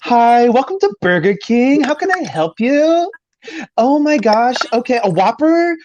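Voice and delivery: high-pitched, drawn-out drive-thru order-taker; exaggerated high, slow fast-food worker impression; put-on drive-thru greeter. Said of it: "go through the whole order like that".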